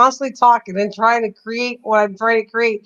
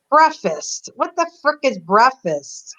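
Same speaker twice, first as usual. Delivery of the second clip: normal talking voice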